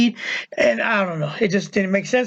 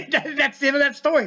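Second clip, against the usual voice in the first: higher, talking through a real laugh; 5 semitones higher than usual. Real, not acted. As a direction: let the laugh spill into the words. Laughs after his anecdote's letdown.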